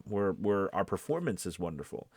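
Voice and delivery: usual speaking voice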